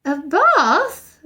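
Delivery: sudden worried alarm; startled mother. A narrator's reading.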